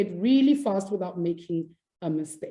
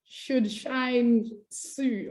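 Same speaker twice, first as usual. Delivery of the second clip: very slow, higher-pitched twister; painstakingly slow tongue twister; careful second try; 4 semitones higher, much slower than usual. Shown as a demonstration. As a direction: stretch out each word to get it right.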